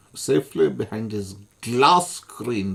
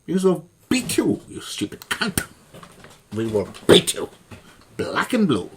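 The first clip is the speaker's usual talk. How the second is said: angry shout; 7 semitones higher, 4 dB louder than usual. Real, not acted. Furious rant at David Napier.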